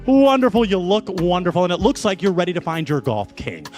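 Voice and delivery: ordinary delivery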